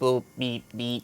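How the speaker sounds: beeping like a toy robot; playful robot beeps. Pretend. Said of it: "robot impression".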